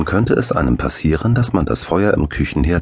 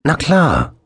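high, loud breezy reply; cheery yes; 11 semitones higher, 3 dB louder than usual. A narrator's reading. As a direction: toss it off happily.

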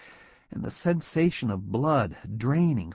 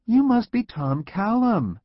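bright, much higher and slow welcome; enthusiastic; 12 semitones higher, 4 dB louder than usual. A narrator's reading. Secretary welcoming the new visitor with enthusiasm. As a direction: greet him eagerly, pitched high. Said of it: "she said with enthusiasm".

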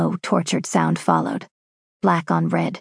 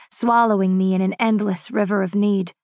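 plain reading voice

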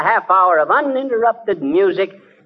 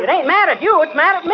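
higher, urgent frightened protest; panicked; 9 semitones higher than usual. Acted. McGee telling Molly the machine targets him, panicked high voice. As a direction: blurt it out in a scare.

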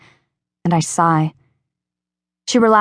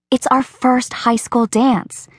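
higher, slow, lightly voiced eager girl; Rachel's voice; 6 semitones higher, slower than usual. Rachel talking her friend into homecoming, slow and higher. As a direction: nudge her gently toward the dance.

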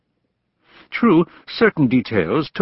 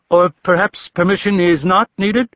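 higher mocking question to the room; crude mockery; 8 semitones higher, 4 dB louder than usual. A narrator's reading. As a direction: sneer it out to the listeners. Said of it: "turned into crude mockery at Kay's expense".

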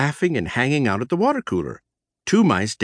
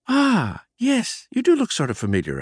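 vague recognition; 11 semitones higher than usual. Acted. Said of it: "I nodded".

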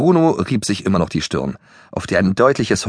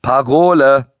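raised, drawn-out call for the password; louder demand; 5 semitones higher, 6 dB louder, slower than usual. A narrator's reading. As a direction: demand it loudly, stretching the word. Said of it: "fragte Bruno lauter". (lauter, langgezogen gefordert)

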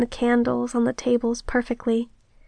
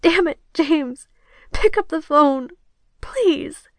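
higher, desperate demand; frustrated plea in a raised pitch; choked pleading; 5 semitones higher, slower than usual. Acted. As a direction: beg him, choking up. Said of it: "I was choking on my words".

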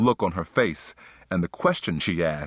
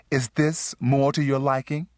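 pitched-up, mock-obliging woman's voice; sarcastic; 8 semitones higher than usual. A narrator's reading. Sheila hands her lover a plain towel, sarcastically. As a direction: offer it with sarcastic sweetness, higher. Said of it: "Sheila said sarcastically".

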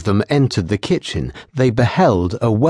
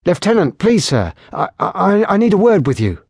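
higher, stammering frightened voice; nervous young patrolman; 9 semitones higher than usual. Acted. Frightened patrolman begging the lieutenant for a word, higher and stammering. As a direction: plead for a word, shaken.

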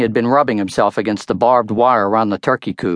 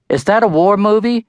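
suspicious, pointed, higher question; sharp question; 10 semitones higher, 3 dB louder than usual. A narrator's reading. Sharp suspicious question. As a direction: mom suspiciously questions her son about the movie. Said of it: "Mom asked sharply".

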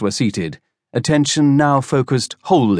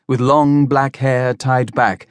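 usual speaking voice

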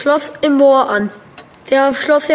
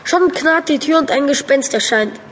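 ordinary talk, nothing marked

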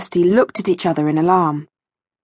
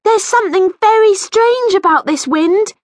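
put-on high, raised-voice girl's shout; 12 semitones higher, 4 dB louder than usual. A narrator's reading. Rachel shouts to her friend while chasing the balloon, high shout. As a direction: shout it high over the wind. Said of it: "she shouted".

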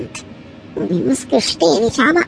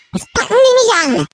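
much higher, startled protest; alarmed yelp; 10 semitones higher than usual. Acted. Puppy being grabbed, yelping much higher. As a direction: squeal it as hands grab you.

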